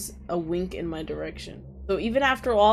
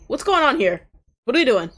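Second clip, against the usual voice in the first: louder and higher, caught off guard; startled outburst; 5 semitones higher, 8 dB louder than usual. Real, not acted. Player thrown by a stranger's remark, startled outburst. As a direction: blurt it out in confusion.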